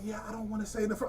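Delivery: lightly voiced tactful mother, fading out; mock polite lady. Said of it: "So she was like".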